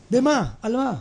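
normal talking voice

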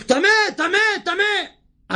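high shouting leper. Acted. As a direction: shout it high to warn everyone off. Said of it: "אז המצורע צועק".

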